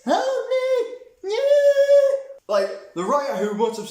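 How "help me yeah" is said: high, loud, hammed-up girl's cries for help; mock a screaming kid, way up high and loud; mock little girl screaming. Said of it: "her just screaming".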